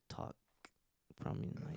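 whispery, from the back of the throat; breathy throaty voice. Shown as a demonstration. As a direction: push air through a breathy throat. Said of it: "talk from your chest, back of throat".

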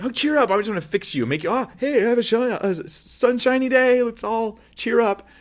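high, sing-song forced cheer; mock cheery fixer. Pastor mocking a fixer at a grieving friend, high chirpy cheer. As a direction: chirp it like a relentless optimist. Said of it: "and I'm like".

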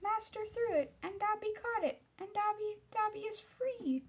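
high-pitched elf, dawning disbelief; Dobby. Acted. Elf realizes the caught sock frees him, in disbelief. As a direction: squeak it as an astonished little house-elf. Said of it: "said Dobby in disbelief".

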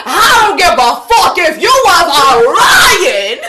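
put-on loud, laughing through a drunk retort; drunk laughing shout. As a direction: bark it out while cracking up. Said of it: "She said".

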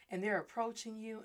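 normal talking voice

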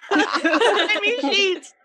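high, laughing out the words. Real, not acted. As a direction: break into giggles. Laughing at her own story.

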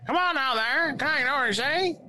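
high, hammed-up dub parody; mock dubbed voice. Gamer parodying the character's dub in a high voice.

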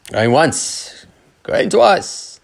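brisk auctioneer countdown. Pretend. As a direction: call it like a playful auctioneer. Mock auction to end questions.

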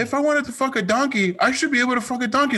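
high-pitched mock outrage; hammed-up indignant objector, high voice; mock indignant protest. Acts out indignant objector to law.

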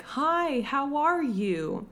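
high-pitched, drawn-out American greeting; Daisy Ridley. Retold or imitated. Podcaster mimics an actress attempting American, high and drawn-out. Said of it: "Daisy was like".

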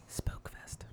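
hushed, voiceless redo; barely-there whispered announcement; chastened whisper. Pretend. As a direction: whisper it meekly after being told off. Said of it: "I'll do it again".

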